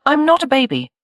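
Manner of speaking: put-on high piping toddler voice; indignant toddler. A narrator's reading. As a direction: pipe up like an offended small child. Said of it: "piped the toddler indignantly".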